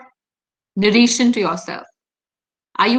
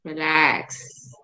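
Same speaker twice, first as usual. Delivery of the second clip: low, soft calming "Relax."; calm exhale into relaxation; soothing self-talk; 7 semitones lower, 6 dB quieter than usual. Shown as a demonstration. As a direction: let it out on the breath, gently. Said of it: "Pick your name".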